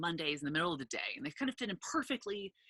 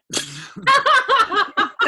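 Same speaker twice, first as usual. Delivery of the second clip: loud, high burst of real laughter; bursting out laughing; big laugh; 16 dB louder than usual. Real, not acted. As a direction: crack up at the memory. Laughing at her own story.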